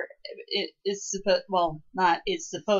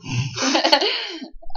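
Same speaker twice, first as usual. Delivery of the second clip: short bright burst of laughter, higher; real laugh; 8 semitones higher than usual. Real, not acted. Cohost laughing at the host's self-deprecating joke. Laughs at "how I feel right now.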